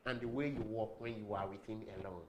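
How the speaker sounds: normal talking voice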